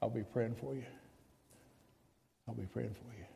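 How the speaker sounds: very soft, low murmured prayer line; hushed teasing aside. Acted. Preacher teasing doubting Christians in a hushed murmur. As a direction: drop almost to a murmur, teasing. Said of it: "put your faith hat on".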